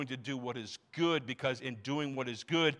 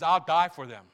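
louder, firm restatement; firm declaration; 2 semitones higher, 9 dB louder than usual. Acted. Pastor repeating Jesus' vow to the church, firm and louder. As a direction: declare it out loud with conviction.

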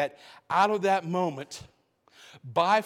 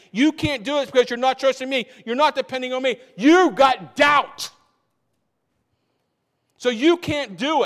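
raised, forceful rebuke building to a shout on doubt; voicing Jesus; 4 semitones higher, 5 dB louder than usual. Jesus telling the disciples they lack trust, forceful. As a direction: drive it home like a stern master.

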